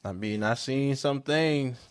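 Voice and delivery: ordinary talk, nothing marked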